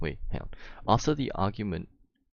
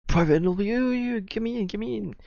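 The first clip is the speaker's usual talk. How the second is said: high, loud mocking whine; squeaky voice begging to join; mocking eager friend; 7 dB louder than usual. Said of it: "He's always like".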